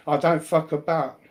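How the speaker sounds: ordinary talk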